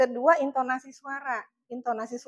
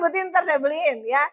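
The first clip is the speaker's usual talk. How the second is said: ordinary delivery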